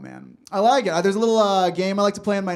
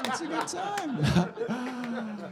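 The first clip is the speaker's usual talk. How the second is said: soft, extremely slow, laughing seductive drawl; mock sultry murmur; 5 semitones higher, 7 dB quieter, much slower than usual. Comic repeats his flirty line to the crowd, soft slow mock sultry. Second take, dropped low.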